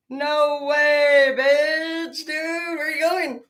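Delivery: high, loud jeering yell that breaks into a whine; mocking shout. Jeering at someone with a flat tire, a loud mocking shout. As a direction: taunt the driver stuck with a flat, shouting. Taunting a tire-trap victim.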